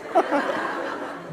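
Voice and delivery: breathy chuckle; a short airy giggle at his own joke; airy laugh. Real, not acted.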